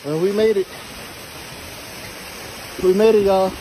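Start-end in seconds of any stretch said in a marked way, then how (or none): none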